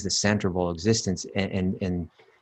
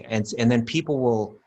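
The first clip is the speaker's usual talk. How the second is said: ordinary talk, nothing marked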